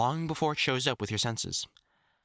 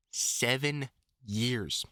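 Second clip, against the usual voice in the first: slow emphatic; much slower than usual. Real, not acted. Stressing the long training.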